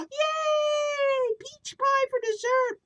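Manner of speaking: very high, loud delighted shout; excited cheer. Acted. Kids thrilled grandma makes peach pie, high cheering shout.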